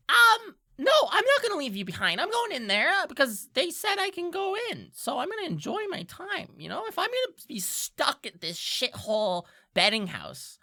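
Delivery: loud, high, defiant creature voice; cocky high-pitched imp, drawing out the boasts; Diplin, indignant imp. Acted. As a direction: protest excitedly as a squeaky little imp.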